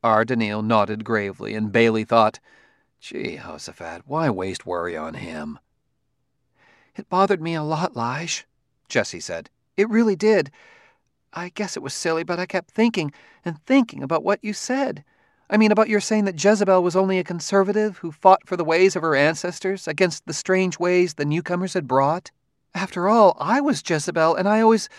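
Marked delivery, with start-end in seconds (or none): 3.0-5.6 quiet thought. A narrator's reading. Inner thought. 7.0-24.9 higher, chattering wife; rambling, nervous wife. A narrator's reading. Wife voiced in story.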